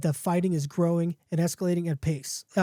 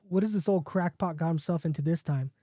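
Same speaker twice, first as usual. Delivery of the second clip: ordinary delivery, nothing marked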